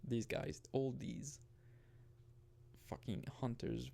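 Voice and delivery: ordinary delivery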